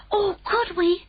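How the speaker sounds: put-on high, excited child's cry; eager cry. A narrator's reading. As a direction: blurt it out with hungry joy. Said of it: "Sophie cried".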